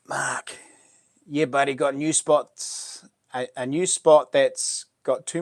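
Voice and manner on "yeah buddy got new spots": ordinary talk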